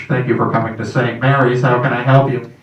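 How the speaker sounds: loud, low voice through a speaker box; drive-through speaker. Pretend. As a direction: speak like a fast-food intercom.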